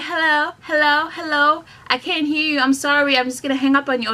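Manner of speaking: mock confused hello into the phone; imitating a caller who can't hear. Caller pretending the line is bad, mock confused. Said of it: "this is what they do".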